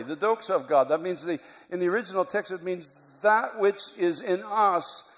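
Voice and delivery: ordinary delivery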